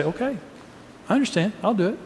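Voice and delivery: tender reassurance to a spouse who needs to be heard; caring. Shown as a demonstration. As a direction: say it so they hear you truly care. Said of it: "If I care, I say".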